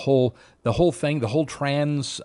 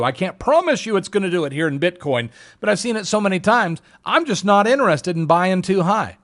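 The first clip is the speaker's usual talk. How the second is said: usual speaking voice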